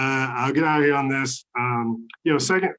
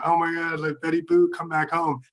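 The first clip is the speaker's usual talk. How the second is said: mock pleading; 6 semitones higher than usual. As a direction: beg the runaway dog to come home. Humans begging dog return.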